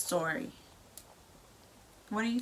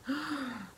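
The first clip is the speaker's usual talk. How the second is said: a soft, hammed-up gasp for a child; mock gasp; 6 semitones higher, 4 dB quieter than usual. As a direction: gasp as if shocked by the story. Reacting to story for child.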